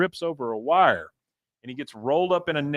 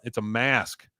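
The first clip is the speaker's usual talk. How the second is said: normal talking voice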